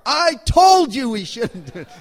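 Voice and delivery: loud, high I-told-you-so; mock outraged scolding. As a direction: explode in a comic spousal reproach. Retold wife's I-told-you-so outburst.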